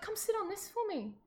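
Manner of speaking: high, soft luring voice; coaxing witch. Acted. Witch coaxing girl onto paddle.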